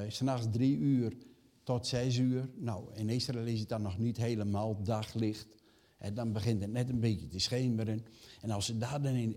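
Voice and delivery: usual speaking voice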